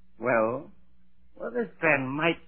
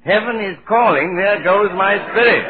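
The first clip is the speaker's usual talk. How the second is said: higher and much louder insistent call; loud repeated cue; 7 semitones higher, 10 dB louder than usual. Pretend. Topper shouting his cue for the late spirit, loud. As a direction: bellow the cue so the spirit hears. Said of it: "why do you repeat that".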